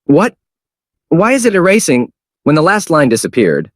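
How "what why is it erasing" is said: high surprised shout. Acted. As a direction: cry out in alarm. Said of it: "exclaimed in surprise".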